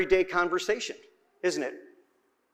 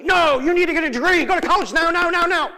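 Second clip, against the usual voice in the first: higher, louder nagging parent; insistent parent; 7 semitones higher, 3 dB louder than usual. Retold or imitated. Parent pushing a teen to college, higher and louder. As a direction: nag the kid toward college, sharp and high. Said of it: "I'm being dramatic".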